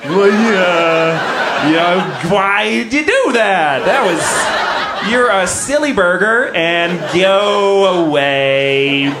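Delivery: a hint of a lenient judge scolding; mock judge. Judge letting a man off with a silly scolding. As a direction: lightly play the judge waving it off. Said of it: "The judge should be like".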